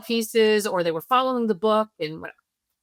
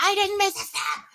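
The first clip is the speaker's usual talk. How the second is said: put-on much higher, fast, indignant kid voice; angry child; 10 semitones higher than usual. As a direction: snap back like an offended eight-year-old. Child snapping at parent.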